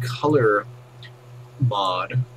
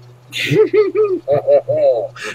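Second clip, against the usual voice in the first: high, loud cartoon French chef laugh; 9 dB louder than usual. French chef laughing in his kitchen, hammed-up high laugh. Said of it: "French chef dude".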